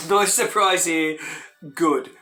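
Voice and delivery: usual speaking voice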